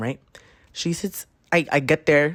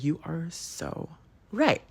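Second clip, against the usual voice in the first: slow, much softer, drawn-out awe; mock awed agreement; 2 semitones higher, 7 dB quieter, slower than usual. Telling her friend she's so right, slow and hushed. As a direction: stretch it out as if totally convinced.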